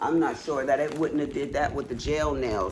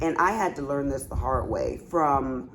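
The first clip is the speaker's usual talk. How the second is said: ordinary talk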